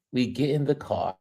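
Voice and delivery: ordinary talk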